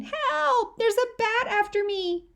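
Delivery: high shrieking cry for help; panicked yelling. A narrator's reading. Sister fleeing a bat through the house: high panicked shriek. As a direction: scream as if a bat is chasing you.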